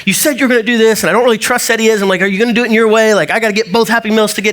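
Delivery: put-on high, fast, worried child's pleading; anxious little kid. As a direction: plead like a fretful kid in the back seat. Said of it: "me feeling like this little kid".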